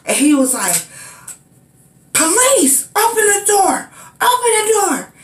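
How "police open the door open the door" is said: high, forceful mock police shout at the door; imitating a police yell. Policeman at her door, demanding entry, high mock shout. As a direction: bark it like a cop pounding on the door. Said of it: "yelling at the top of his lungs".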